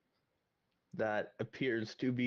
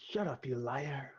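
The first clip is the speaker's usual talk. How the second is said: loud whisper. Acted. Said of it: "I whisper loudly across the hall".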